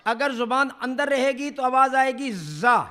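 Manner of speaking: ordinary talk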